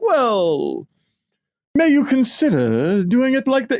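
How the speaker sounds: high, prim mock British politeness; mock British hint. British publishers' gentle hint, in a high mock British voice. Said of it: "on the British side, they're like".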